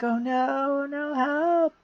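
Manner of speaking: high, hammed-up wail at normal volume; mock scream for help. Kuth as his memory gets erased, mock scream for help. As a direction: pretend panic, pitch climbing on each cry. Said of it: "They're all screaming for help".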